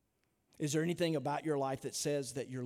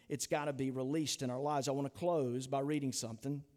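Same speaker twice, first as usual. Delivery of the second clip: normal talking voice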